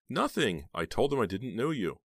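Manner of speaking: high, pleading denial; high nervous denial; anxious denial. A narrator's reading. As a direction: insist nervously she said nothing. Heather frightened, denying.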